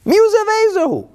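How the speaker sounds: high, loud startled exclamation; shocked king. Retold or imitated. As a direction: blurt it in alarm. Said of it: "He's like".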